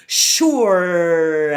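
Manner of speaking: "Surely" said slowly, sh, bunched R, L; slow careful word. Shown as a demonstration. Coach models surely slowly. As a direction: say "Surely" slowly through each position. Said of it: "Notice the different positions".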